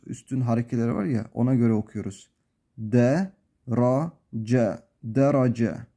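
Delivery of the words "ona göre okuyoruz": ordinary talk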